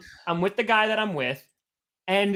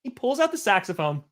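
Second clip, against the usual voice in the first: normal talking voice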